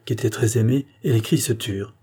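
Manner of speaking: ordinary delivery, nothing marked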